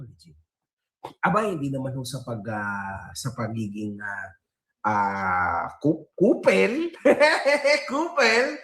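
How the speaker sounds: ordinary delivery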